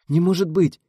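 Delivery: high, loud stunned outburst. A narrator's reading. Rita, stunned by her double, blurts out in shock. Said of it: "ошеломлённо вытолкнула Рита".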